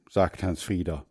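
ordinary delivery

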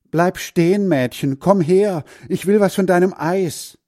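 high, loud calls while chasing; calling out. Acted. Hans Frieder chasing the girl, loud high calls. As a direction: yell at her to stop.

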